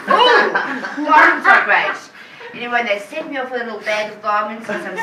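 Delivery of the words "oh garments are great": loud, high oh, eager sales gush; retelling her eager first sales pitch, loudly gushing; mock gushing. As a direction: gush about the garments. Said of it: "I said".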